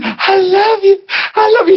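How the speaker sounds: high, swooning praise; over-sweet worship voice; mock gushing worship. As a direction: ham up the adoring ladies. Mocking worshippers.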